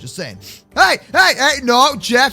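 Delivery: usual speaking voice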